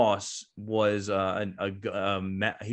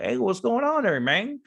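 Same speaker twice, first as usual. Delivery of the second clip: imitated Florida accent, pitched much higher; mock a Florida recruiter's greeting in a much higher voice; a sergeant hails a teen in a mock Florida accent; 12 semitones higher, 4 dB louder than usual. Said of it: "with his little Florida accent".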